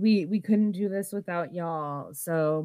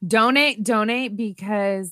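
normal talking voice

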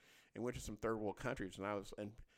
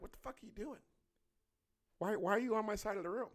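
higher-pitched, puzzled retold challenge; baffled challenge; 7 semitones higher than usual. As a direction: question an intruding roommate, mildly indignant. Confronts roommate on his side.

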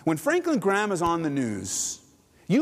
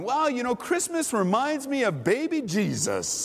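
lightly voiced Southern preacher, pitched higher; Franklin Graham; 6 semitones higher than usual. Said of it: "he has that deep Southern voice".

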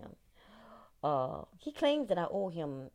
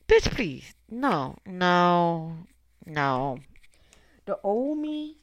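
high, loud, drawn-out "bitch, please"; sassy dismissal; 5 semitones higher, 7 dB louder, slower than usual. Real, not acted. To haters, the new her brushing them off sassily. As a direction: toss it off with scorn.

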